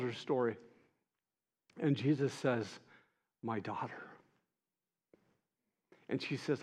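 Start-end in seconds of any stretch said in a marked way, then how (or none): 3.4-4.0 much softer, gentle "daughter"; tender. Acted. Jesus tenderly calls the healed woman daughter, hushed. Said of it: "the tenderness of that".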